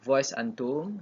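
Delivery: ordinary talk, nothing marked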